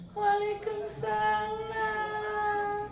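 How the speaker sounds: very high, slow, sung wail of a female ghost; bride's eerie high sing-song reply; ghostly kuntilanak voice. Acted. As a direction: croon it like a kuntilanak in the dark. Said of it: "siun kuntilanak".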